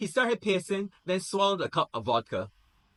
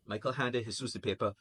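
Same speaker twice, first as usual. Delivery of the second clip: usual speaking voice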